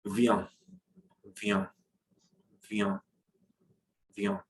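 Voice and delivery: "viens" said slowly, vowel hummed through the nose; repeated nasal drill. Shown as a demonstration. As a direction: drill "viens" with a nasal hum each time. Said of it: "that will be considered a nasal sound".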